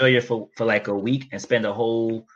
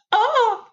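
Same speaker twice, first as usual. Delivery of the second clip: a sudden, much higher shout; loud yelp; 4 dB louder than usual. Pretend. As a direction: call out sharply to scare off a coyote. Pretend call at a coyote.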